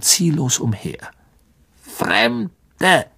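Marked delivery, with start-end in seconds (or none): 1.8-3.1 high, two split syllables; clicking spider. A narrator's reading. A spider reporting strangers to the elder, high clicking voice. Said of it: "klickte die Spinne".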